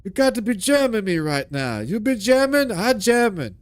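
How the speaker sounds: half-hearted Jamaican accent impression; mock Jamaican accent. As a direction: Seagal faking a Jamaican accent. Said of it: "walks around a bunch of Jamaicans".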